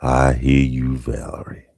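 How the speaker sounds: low, deep playful croon; put-on deep voice. Host answering Valerie who prefers deep voices, put-on deep. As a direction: sink into a deep voice to please.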